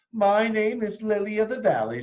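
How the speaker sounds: high, proud announcement; eager schoolgirl. A narrator's reading. Victoria claiming a flower name, high and eager. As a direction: declare it grandly. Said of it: "said Victoria".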